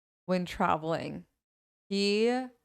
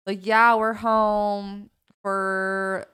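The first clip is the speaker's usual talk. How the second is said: slow, loud drawn-out local bro; hammed-up townie; 5 dB louder, much slower than usual. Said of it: "We're gonna pretend to be townies".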